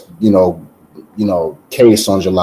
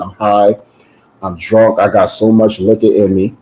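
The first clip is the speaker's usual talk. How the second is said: ordinary talk, nothing marked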